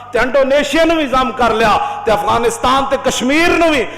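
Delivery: loud, rising sermon cadence, each clause hammered; fervent preaching. Acted. Preacher rousing his congregation, loud and fervent. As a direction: preach it at full force to the crowd. Fervent build-up about the nation.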